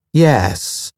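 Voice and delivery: a slow, even machine-like calm; a lightly voiced calm ship mind. A narrator's reading. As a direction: answer with total, unhurried calm. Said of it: "The very calm voice came from the speakers".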